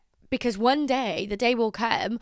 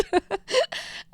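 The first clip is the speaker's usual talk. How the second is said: laugh; 11 semitones higher than usual. Real, not acted. Laughing after joke.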